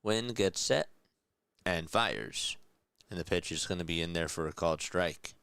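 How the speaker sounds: live baseball announcer calling the pitch; play-by-play call. Acted. Streamer calling the game for chat, in announcer voice. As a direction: call it like a broadcaster in the booth.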